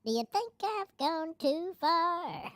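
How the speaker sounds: chipmunk-high squeaky voice; slow, high chipmunk voice; helium voice. Pretend. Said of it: "I don't need the filter to talk like a chipmunk".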